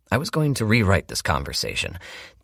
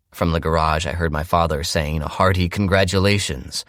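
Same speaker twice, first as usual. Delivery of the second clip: ordinary delivery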